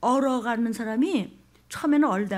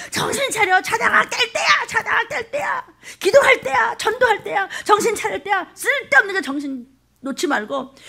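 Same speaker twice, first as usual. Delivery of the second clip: much higher, loud urgent exhortation; fiery preaching; 11 semitones higher, 4 dB louder than usual. Real, not acted. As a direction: rouse the flock, shouting. Preaching at full force.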